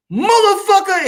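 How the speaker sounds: high, loud cry of the curse word; mock cursing shout. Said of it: "a motherfucker say".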